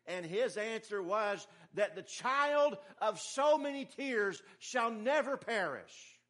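impassioned preaching. Real, not acted. Preacher telling of Monica praying for her son, impassioned. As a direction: carry the story up to a ringing promise.

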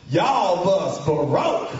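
very slow drawn-out country drawl; country mechanic. Retold or imitated. Greasy mechanic telling the kids their bus is broken. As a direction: stretch every word like a country mechanic.

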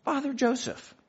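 called out high and bright with delight; warm greeting. Retold or imitated. The host greets his guest by name, calling out high. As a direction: hail him like an old friend. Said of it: "And he's like".

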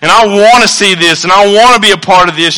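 high, loud cry of wonder to God; fervent preacher's shout of awe; awed shout. Retold or imitated. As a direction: shout your praise like you can't contain it. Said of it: "where I'm like".

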